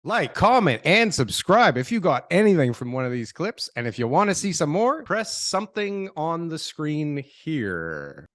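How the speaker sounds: punchy, high-pitched sign-off pitch; outro call to action. Acted. Host asking viewers to like and subscribe, punchy outro pitch. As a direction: sell the subscribe, then slow down at the end.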